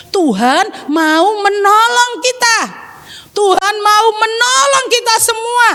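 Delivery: proclaiming. Acted. Child assures mama that God will help, proclaiming. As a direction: proclaim it with building conviction.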